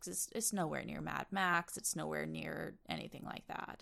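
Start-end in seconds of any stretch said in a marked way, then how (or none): 1.8-3.8 whispering. Real, not acted. Aside muttered after the point.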